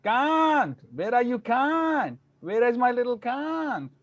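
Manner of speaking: high, drawn-out calling for a lost dog; calling out a name. Retold or imitated. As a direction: call the dog's name, high and slow. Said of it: "I wandered the streets for hours calling his name".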